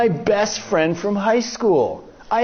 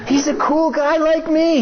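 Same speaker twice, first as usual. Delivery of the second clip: higher-pitched, disbelieving reasoning; bewildered protest; 6 semitones higher than usual. Acted. Man argues with himself about his friend, bewildered protest. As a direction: insist it cannot be so.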